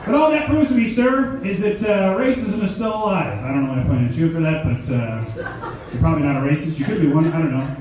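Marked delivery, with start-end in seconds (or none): 0.1-3.4 much higher and louder, booming; big stage opener. Acted. Stand-up comic booming a bold claim at the crowd. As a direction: announce it to the whole room.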